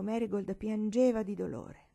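plain reading voice

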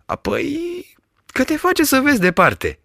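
put-on high, simple old shepherd; lightly voiced rustic shepherd. A narrator's reading. Shepherd praises the spyglass, high and simple.

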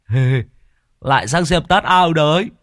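high, loud mocking jibe; taunting. Tứ taunts Thọt as he shuffles over, loud and mocking. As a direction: needle him with a sneer. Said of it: "đã nói kháy".

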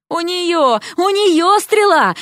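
high-pitched agitated cry; frantic yell. A narrator's reading. Vasya tells him her friend has the arrow, frantic. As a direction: cry out in a panic. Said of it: "завопила Вася".

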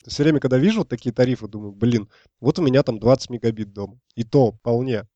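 ordinary delivery

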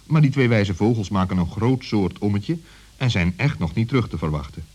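usual speaking voice

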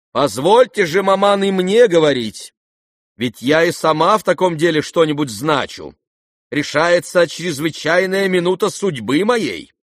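high, loud, emphatic demand; insistent. A narrator's reading. Daughter demands mother let her speak, insistent. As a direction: demand your right to speak.

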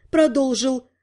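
usual speaking voice

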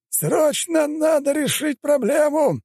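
very high, strained, drawn-out yell; alarmed cry to the crowd; elder's shout. A narrator's reading. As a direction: shout the order urgently at the top of the range. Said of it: "закричал старейшина".

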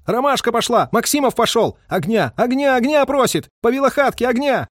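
very high-pitched signalman's cries; high, insistent alarm calls; urgent shouting. A narrator's reading. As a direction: shout the plea for fire. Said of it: "закричал радист".

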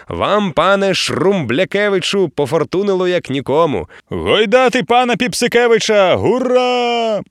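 high, loud, jubilant captain's call; rally the crew to toss him; hearty cheer. A narrator's reading. Captain rallying crew to toss the hero, jubilant call.

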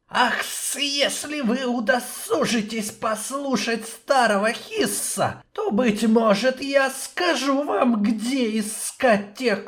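high, slow hissing serpent; hissing snake voice. Acted. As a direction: stretch every s like a snake. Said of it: "дерзко прошипел Хис".

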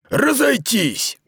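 high, slow drawn-out order; command. Acted. Aradai orders the prefects away, commanding. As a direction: bark the order to the troops. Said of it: "скомандовал он префектам".

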